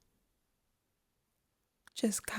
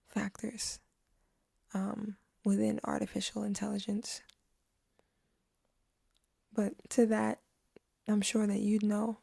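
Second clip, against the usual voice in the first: ordinary talk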